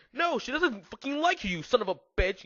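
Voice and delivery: high, heated retort; yelled. A narrator's reading. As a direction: squeal it in jealous anger. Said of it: "yelled Draco".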